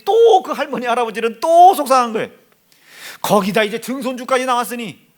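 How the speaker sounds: loud and high, preaching at full force; fiery preaching. Acted. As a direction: thunder it from the pulpit. (강단에서 목청껏 외치는 설교)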